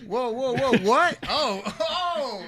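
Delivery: loud high startled whoa; surprised shout. Real, not acted. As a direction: yelp in shock and laughter. Startled reaction to horse line.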